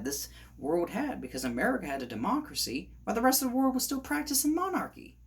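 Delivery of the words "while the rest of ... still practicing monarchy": high, fast, exasperated rush; worked up. Real, not acted. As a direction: race through it, getting carried away. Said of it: "it gets to me".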